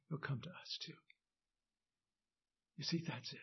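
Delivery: ordinary talk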